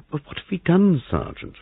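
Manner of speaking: high, plaintive question, softening away. A narrator's reading. Hostess asking the sergeant what they did, plaintive. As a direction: appeal to him high and plaintively. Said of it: "Molly demanded plaintively".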